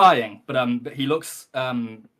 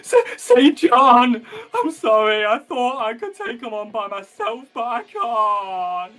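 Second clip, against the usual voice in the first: high, slow, hammed-up sobbing wail; crying and blubbering; 8 semitones higher, 6 dB louder, slower than usual. King apologises to Saint John, exaggerated blubbering. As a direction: stammer and bawl, stretching out the words. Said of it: "crying and blubbering".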